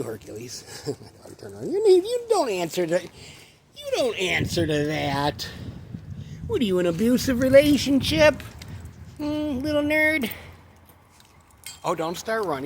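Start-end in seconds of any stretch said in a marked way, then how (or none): 1.7-10.3 high, mocking voice talking down to the dog; mock-scolding the dog in a high voice; teasing the dog. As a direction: needle the dog in a high silly voice.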